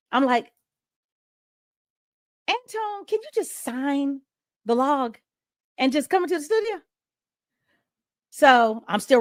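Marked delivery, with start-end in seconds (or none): 2.5-6.8 high-pitched, mildly exasperated retold request; exasperated plea. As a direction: plead with him to just do it, voice lifted high. Retold exasperated plea while busy.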